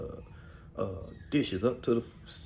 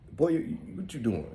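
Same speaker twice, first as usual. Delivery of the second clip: higher, scolding aunt voice pitched up; aunt's tough-love voice; 7 semitones higher than usual. Retold or imitated. Tough aunt snapping at a sad boy, voiced high. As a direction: bark it like a stern aunt. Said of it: "She'd be like".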